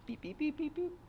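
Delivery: high, quiet mock beeping; imitated machine beeps. Narrator mimicking the monitor beeps at diagnosis, high quiet beeps. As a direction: chirp it like a hospital monitor.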